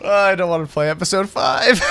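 half-yell it while laughing; laughing shout. Real, not acted. Gamer refusing the next episode, laughing shout.

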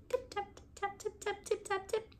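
high, soft squeaky mouse voice; little tip taps squeaked high and soft; tiny mouse tapping. A narrator's reading. Narrator voicing mice tapping under the teacups, high and soft.

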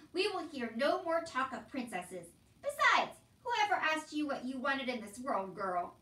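loud, forceful rebuke; scolding uncle. A narrator's reading. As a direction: lay down the law loudly. Uncle lays down the law.

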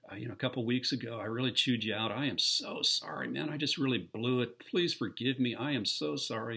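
high, earnest apology called down from the house. Retold or imitated. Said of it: "I yelled down".

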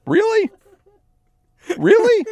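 high, loud disbelieving mock; shrill sarcastic disbelief; incredulous mockery. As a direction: throw her excuse back at her. Mocking drunk driver's excuse.

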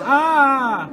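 sudden loud, high "ah"; startled scream. Real, not acted. Player cut off by a scare, startled scream. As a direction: scream mid-thought as if jumped.

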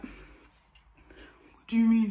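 slow breathy whisper of a confused guy; imitating a nervous boyfriend whispering; mock whisper. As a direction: whisper it slowly, baffled. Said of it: "And he'll be like".

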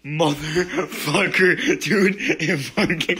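slow, drawn-out laughing swearing; laughing curses. Real, not acted. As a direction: curse while laughing through pain. Laughing curse after the burn.

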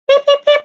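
high comic beeps; silly beeping. Retold or imitated. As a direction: squeak it out like a joke. Children's answer for pig.

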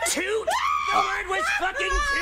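laughing exasperation. Acted. Exasperated reveal.

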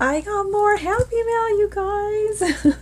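high excited gush; excited squeal. Real, not acted. Excited about new happy mail.

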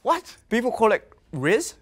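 sudden high yelp of surprise; surprised. Real, not acted. Learning what Riz means, a shocked high yelp. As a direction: jump up in pitch, caught off guard.

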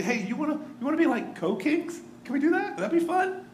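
mock sweet Herod. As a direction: ham up a falsely friendly king proposing co-kings. Herod mockingly offers co-kingship.

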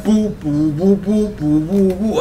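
mouth-made trombone toots; imitated trombone. Host hyping the video with a jam, imitating trombone. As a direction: toot like a trombone in your own range. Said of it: "With a trombone jam".

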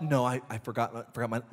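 put-on soft, trailing child voice; a kid admitting she forgot; sheepish little girl. Child voice in retold scene.

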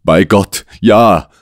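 mild exclamation of the desperate guest; exclaimed. A narrator's reading. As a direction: call it out in despair. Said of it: "rief unser Gast".

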